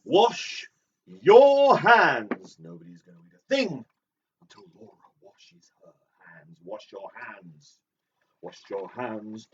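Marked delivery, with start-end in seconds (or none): 0.0-2.6 high, loud mock command; hammed-up yell, words drawn apart; shouted order. Quoting a line as a loud order. 6.6-9.5 slow, softer quoted instruction; quiet, measured recital; subdued repetition. Acted.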